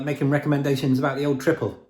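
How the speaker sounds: ordinary delivery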